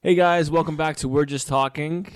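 show intro. Real, not acted. Podcast intro greeting.

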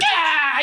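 mock high-pitched scream; hammed-up movie yelp. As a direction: cry out "ah" like an over-the-top actor.

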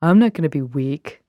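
firm vow in a slightly deepened voice. Acted. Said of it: "Just had to do a deeper voice".

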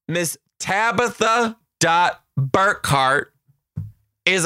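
high, slow, drawn-out announcer call; winner announcement. Pretend. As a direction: announce the winner with big drumroll pauses.

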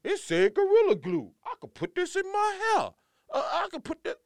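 high, put-on voice of a clueless woman; a dumb voice mocking the glue girl; mocking a clueless person. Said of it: "mother effers like this on the planet that still will just".

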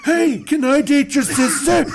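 high, loud bro voice; loud high mock suitor; eager suitor. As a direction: ask it like a pushy frat guy. Voicing eager Egyptian suitor.

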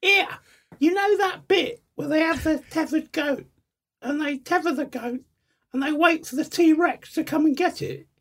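high Essex voice, cackling at the end; imitating Sarah's Essex voice. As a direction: Essex impression, teasing. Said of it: "she talks like this".

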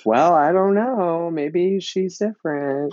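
high, slow, dopey mock voice; clueless leading man. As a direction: drag it out like a clueless mark. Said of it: "And he was like".

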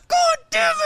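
very high, loud mimicked curse; mock angry outburst. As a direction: wail the curse in exaggerated frustration. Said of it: "He's like".

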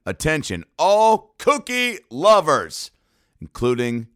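slow, high, hammed-up announcer call. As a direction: boom it out like a mock PA announcement. Mock announcement for cookie lovers.

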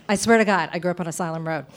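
ordinary talk

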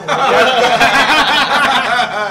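a loud high burst of laughter. Real, not acted. Laughing about the show's dwarf.

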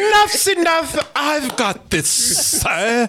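high, slow, hammed-up British accent; mock British accent. Actor brushing off his vocal coach, high mock British accent. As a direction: overdo a bad British accent, pitched well up.

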